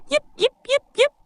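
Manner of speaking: tiny high-pitched puppy yipping; quick, high little yips like a small pup. Acted. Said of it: "Think of a little puppy going".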